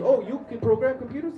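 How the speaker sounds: high, loud imitated question; excited mock challenge. Subway stranger challenged about computer job, high incredulous mock question. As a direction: pitch shot up, rising at the end.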